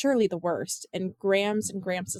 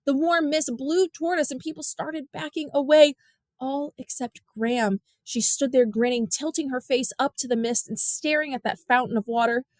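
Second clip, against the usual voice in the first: usual speaking voice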